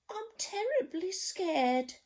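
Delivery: high, whimpering "I'm scared". Acted. Storyteller voicing the cook, high and whimpering. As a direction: whimper it like a timid child. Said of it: "the cook declared".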